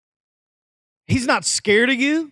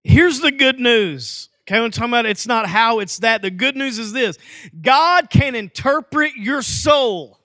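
punchy pulpit delivery; emphatic preaching; 2 semitones higher, 5 dB louder than usual. Real, not acted. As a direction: preach the good news with full conviction.

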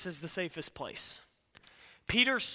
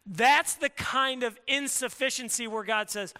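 usual speaking voice

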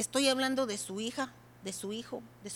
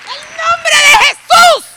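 slow, much higher, very loud cry of the name; fervent shout; 21 dB louder, slower than usual. Shown as a demonstration. Preacher showing how to pray at full force. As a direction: belt out the name like a prayer warrior. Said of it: "le voy a decir cómo".

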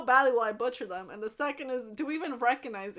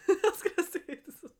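high, laugh-filled words; can't finish for laughing; cracking up; 6 semitones higher than usual. Real, not acted. Cracks up at own thought.

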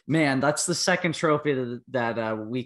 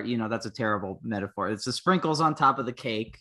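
ordinary talk, nothing marked

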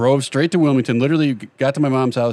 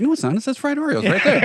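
mock indignant friend; 12 semitones higher than usual. Retold friend insists, much higher.

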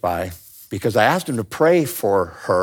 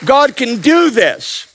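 loud, high impassioned preaching; preaching at full force; 11 semitones higher, 6 dB louder than usual. Acted. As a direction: build the sermon to a roar.

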